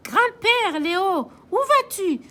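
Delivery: high loud calling after grandpa; Florence calling. Acted. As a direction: call out across the ice. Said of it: "crie Florence". (Florence crie pour l'appeler)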